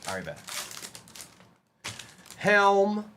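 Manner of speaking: ordinary talk, nothing marked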